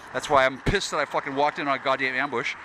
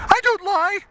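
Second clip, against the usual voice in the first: mock indignant woman; 5 dB louder, slower than usual. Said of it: "she's like".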